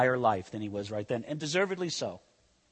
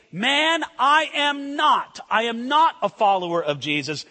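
Peter's loud denial; 7 semitones higher, 6 dB louder than usual. Retold or imitated. Preacher voicing Peter denying to the bystander, high and loud. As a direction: insist loudly, stretching "I am not".

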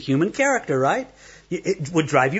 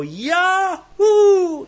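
joyful yahoo; 11 semitones higher than usual. Retold or imitated. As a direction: let out a big happy yahoo. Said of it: "you just hear him go".

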